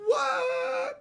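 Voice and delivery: a sudden high, loud "What?"; startled high exclamation; surprised shout. Real, not acted. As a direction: yelp it out in real shock. Sudden reaction while streaming.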